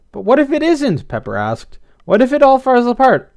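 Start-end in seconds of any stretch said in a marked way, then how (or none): 0.1-1.1 worried. A narrator's reading. Pepper frets it will fail. 2.1-3.3 high, loud fretting question; panicky worry. A narrator's reading. Pepper frets to Blythe about failing, panicky worry.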